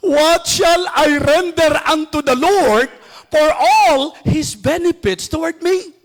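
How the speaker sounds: preacher crying out the psalm verse, slow and fervent; fervent scripture quote. Acted. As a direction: proclaim the psalm as a heartfelt plea. Preacher quoting psalm with force.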